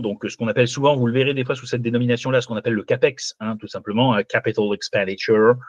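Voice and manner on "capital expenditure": French lecturer showing off an English accent. Shown as a demonstration. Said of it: "j'espère que vous appréciez mon accent anglais". (accent anglais appliqué)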